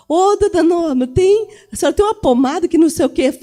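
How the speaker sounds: loud, high mock pleading; imitated keyboardist's plea. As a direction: call out for help, hammed up. Imita tecladista pedindo pomada. (imitação alta de pedido aflito)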